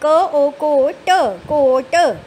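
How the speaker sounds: teacher drawing out each letter loudly; slow loud spelling. Shown as a demonstration. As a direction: stretch every sound for young readers. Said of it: "मेरे साथ साथ पढ़िए".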